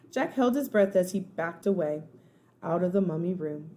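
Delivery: usual speaking voice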